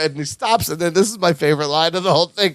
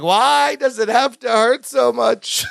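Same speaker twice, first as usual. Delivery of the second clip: whiny Balki; 7 semitones higher, slower than usual. Retold or imitated. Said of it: "Balki's like".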